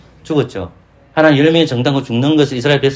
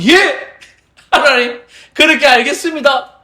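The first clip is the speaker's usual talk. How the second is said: obedient people; 10 semitones higher, slower than usual. Pretend. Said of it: "라고 얘기해야 되잖아요".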